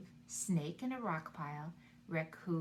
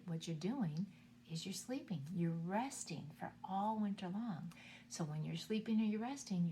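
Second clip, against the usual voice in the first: normal talking voice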